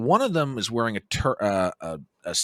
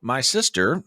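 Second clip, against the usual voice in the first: ordinary talk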